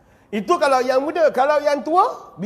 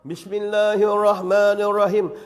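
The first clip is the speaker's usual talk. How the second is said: slow recitation; 3 semitones lower than usual. Acted. Starts the prayer recitation.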